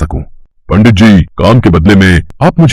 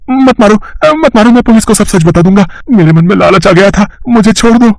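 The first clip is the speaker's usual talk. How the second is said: much higher, desperate pleading; terrified begging; 12 semitones higher than usual. Acted. Thief begging the unseen Hanuman to stop, high terrified pleas. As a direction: beg for mercy between blows. Thief begs not to be hit.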